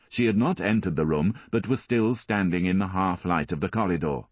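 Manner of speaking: ordinary delivery, nothing marked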